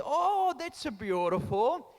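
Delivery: slow, lightly voiced Italian grandmother; a hint of a charmed old nonna; nonna's voice. Grandmother answering her grandson about coffee, slow and lightly voiced. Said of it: "She said".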